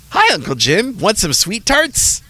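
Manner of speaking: put-on high, bright kid voice; child voice. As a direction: voice a little nephew. Nephew voiced in story.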